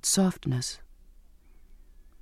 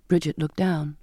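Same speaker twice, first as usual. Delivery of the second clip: plain reading voice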